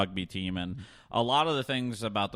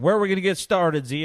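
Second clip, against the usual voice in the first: auctioneer call; 10 semitones higher, 9 dB louder than usual. Retold or imitated. Auctioneer calling out to crowd.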